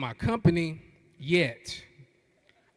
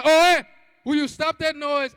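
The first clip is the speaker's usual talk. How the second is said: mom scolding; 9 semitones higher, 5 dB louder than usual. Retold or imitated. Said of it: "She said".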